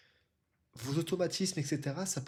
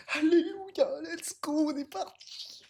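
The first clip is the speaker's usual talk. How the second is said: excited cheer; 13 semitones higher, slower than usual. Real, not acted. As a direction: celebrate the match finally starting. Match finally starts, cheering.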